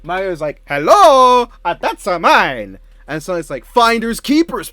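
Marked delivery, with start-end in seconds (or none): 0.7-2.8 high, indignant Italian cartoon accent; Mario Italian accent. Voicing Mario objecting over chair. 3.6-4.7 high, loud, drawn-out taunt; Sonic taunting. Acted. Voicing Sonic taking the chair.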